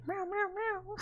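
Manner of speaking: high kitty meow, playfully put on; cat meow. Acts out cat brain rot video.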